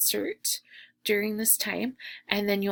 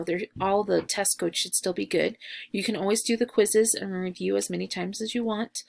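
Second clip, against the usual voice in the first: normal talking voice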